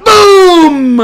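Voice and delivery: loud high boom; shout. Real, not acted. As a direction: belt it out. Goal shout.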